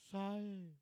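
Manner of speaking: high-pitched mock crying complaint; retold tearful complaint. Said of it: "parkir dan nangis". (pura-pura merengek sambil menangis)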